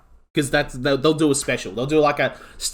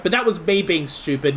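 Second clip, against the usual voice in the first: usual speaking voice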